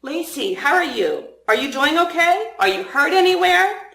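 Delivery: gently curious, lifted voice; curious concern. A narrator's reading. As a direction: check on a friend, questions climbing in pitch. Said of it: "he eyed me curiously and said".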